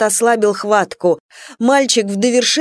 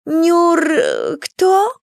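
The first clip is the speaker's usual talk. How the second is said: feigned surprise; 8 semitones higher than usual. Acted. Lady feigns ignorance.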